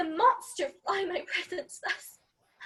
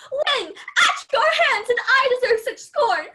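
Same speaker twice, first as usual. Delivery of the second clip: hurt, bitter outcry; 4 semitones higher, 6 dB louder than usual. Acted. Helena crying out that she is mocked, hurt and loud. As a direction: rail against the mockery.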